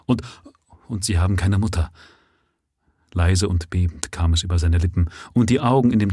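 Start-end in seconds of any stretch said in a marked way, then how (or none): 0.1-1.9 fast, rushed pleading; quick interruption. Acted. Father quickly interrupting the lady, rushed pleading. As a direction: cut in hurriedly before she says no. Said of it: "fiel ihr der Mann schnell ins Wort".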